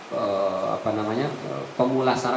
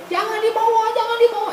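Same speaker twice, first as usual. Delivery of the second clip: very high frightened cry; begging not to be carried off; mock terrified wail; terrified pleading. Corpse screaming don't take me.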